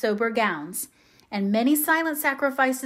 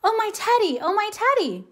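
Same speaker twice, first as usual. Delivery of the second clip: high, joyful cry of Teddy; crying joyfully; 10 semitones higher than usual. Acted. Jo greeting returned Laurie, crying joyfully. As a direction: call his name in glad surprise. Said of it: "crying joyfully".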